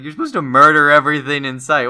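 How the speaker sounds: hammed-up outburst of disbelief; retold villain scolding. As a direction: scold like an exasperated comic-book villain. Voices villain scolding the symbiote.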